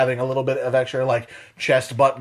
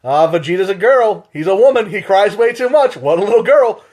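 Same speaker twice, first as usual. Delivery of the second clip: 1 mock teasing taunt; 6 semitones higher, 6 dB louder than usual. Teller mocking his cousin.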